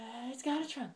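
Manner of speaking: ordinary talk, nothing marked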